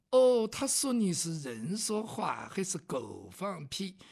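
mild southern drawl. Acted. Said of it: "说话带点南方味儿".

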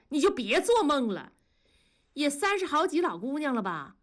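high, mocking jeer; wife sneering at her husband's admirer, high and scornful. A narrator's reading. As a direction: taunt her rival with a cold laugh. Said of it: "庄嫂冷笑着说".

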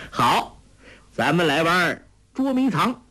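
playful, lifted voice; amused teasing. A narrator's reading. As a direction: propose a game as if toying with her. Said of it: "笑道".

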